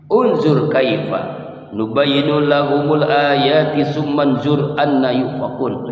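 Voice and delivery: slow chanted Arabic verse; Quranic recitation. Acted. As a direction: draw out the verse slowly. Quran recitation within sermon. (tilawah ayat dengan lambat)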